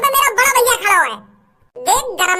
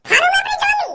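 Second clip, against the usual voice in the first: shrieked threat; 8 semitones higher than usual. Acted. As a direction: yell it out to shock them. Daughter threatens gas officials.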